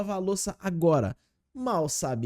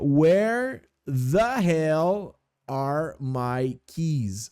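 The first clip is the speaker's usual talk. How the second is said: slow teaching read; 2 semitones lower, 3 dB louder, much slower than usual. Shown as a demonstration. Teacher reading an example sentence aloud, very slowly. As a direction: read very slowly, every word spaced. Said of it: "Olha só o meu exemplo aqui".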